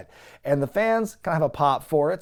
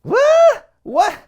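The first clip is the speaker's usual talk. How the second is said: much higher and louder, exasperated disbelief; baffled shout; 10 semitones higher, 6 dB louder than usual. Retold or imitated. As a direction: yell it out, totally lost. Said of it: "And I'm like".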